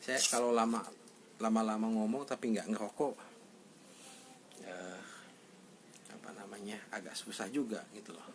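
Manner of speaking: talking around a cigarette; smoking while speaking; cigarette in mouth. Real, not acted. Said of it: "Saya sambil merokok ya".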